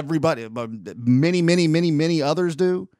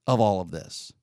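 usual speaking voice

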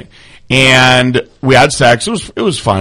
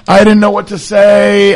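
high, louder imitation of crying; mock crying; 7 semitones higher, 4 dB louder than usual. Woman confessing to the man she slept with, mock crying. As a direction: imitate her wailing excuse up high. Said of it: "she started crying and she was like".

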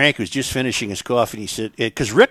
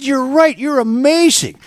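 higher, eager farmer insisting; 5 dB louder, slower than usual. Acted. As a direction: push the generous host, pitched up high. Farmer amazed in joke.

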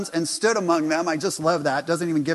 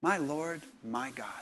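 reverent; 2 semitones lower, 9 dB quieter than usual. Acted. Reverent echo of confession.

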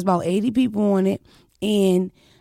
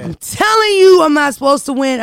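loud, much higher triumphant shout; excited shout; 7 semitones higher, 10 dB louder than usual. Real, not acted. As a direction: shout it like a victory cry. Hyping her underdog win.

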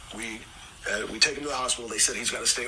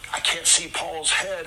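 usual speaking voice

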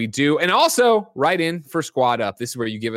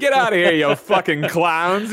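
louder, higher playful telling-off; mock irritation; 5 semitones higher, 4 dB louder than usual. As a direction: shoo them off in mock annoyance. Mock telling off after impression.

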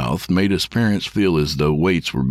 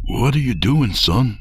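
a higher-pitched father's question; lightly voiced father; 7 semitones higher than usual. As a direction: ask as a hesitant father, lightly acted. Father startles son at night.

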